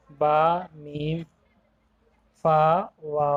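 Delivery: با میم فا وا each said clearly with the lips; teacher showing lip letters. Shown as a demonstration. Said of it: "ہونٹ سے صرف چار حروف".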